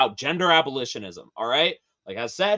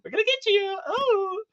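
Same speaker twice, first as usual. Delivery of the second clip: much higher squealing threat; mock shriek. Warning straight people, mock high-pitched squeal.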